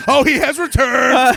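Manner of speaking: high, hammed-up proclamation; mock dramatic cry. Co-host quotes the baby's grown vocabulary, mock dramatic cry. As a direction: cry it out like a grandly spoken baby. Quoting the sketch's grown-worded baby.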